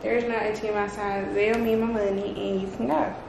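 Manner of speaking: ordinary talk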